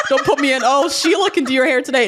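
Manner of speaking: high-pitched, playful diva protest; mock diva refusal. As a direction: wave off the substitute in a high, put-on fuss. Mock salon scene, refusing another stylist.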